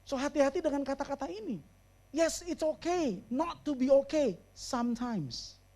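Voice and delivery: ordinary talk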